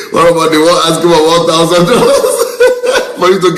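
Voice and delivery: cracking up while asking; laughing. Real, not acted. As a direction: talk through real laughter. Laughing at the absurd demand.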